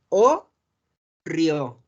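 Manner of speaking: "o río" slowly, split into two parts; silent T. Shown as a demonstration. Teacher concluding the rule, slow "o río" without a T. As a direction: draw out "o", then "río" with no T. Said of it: "la T no se pronuncia".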